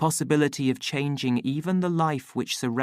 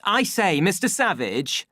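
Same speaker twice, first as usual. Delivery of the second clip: raised, uneasy polite interruption; uncertain smile; 7 semitones higher, 4 dB louder than usual. A narrator's reading. Official to the savage, uncertain politeness. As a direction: try nervously to get his attention. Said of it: "with an uncertain smile".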